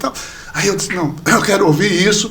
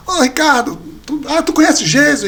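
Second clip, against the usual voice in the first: higher-pitched, curious hail; retold friend; 7 semitones higher than usual. Friend approaches the brother with a question, lightly imitated, higher. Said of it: "ele pegou e disse assim".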